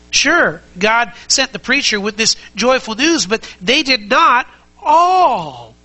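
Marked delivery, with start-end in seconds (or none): 3.3-5.8 slow, drawn-out all with falling tune; stress on ALL. Shown as a demonstration. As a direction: lean hard on the word all, slowly. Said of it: "underline that word all".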